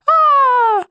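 very high, long "aaah" scream; a girl's answering scream, high and held. A narrator's reading. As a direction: let out a high, sustained yell. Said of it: "и сама заорала".

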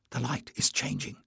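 soft urgent whisper. A narrator's reading. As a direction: warn in a whisper. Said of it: "Jasmine whispered".

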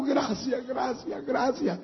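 soft, high mock weeping; imitated tearful thanks. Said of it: "ella lloraba ahí".

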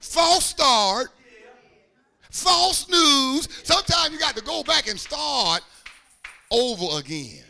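loud, rising sermon shout; full-force preaching. Real, not acted. Preacher urging listeners to start over, full-force preaching. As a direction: build to a forceful, high proclamation.

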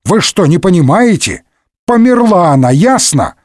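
high-pitched exasperated outburst; indignant. A narrator's reading. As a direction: spell it out in exasperation. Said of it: "возмутился Портос". (возмущённо, на повышенных тонах)

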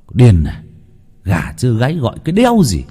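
put-on loud, irritated outburst of a woken drunk; grumpy snap. Half-drunk husband woken early, barking back irritably. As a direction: bite back, annoyed at being woken. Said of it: "cất giọng cáu bẩn".

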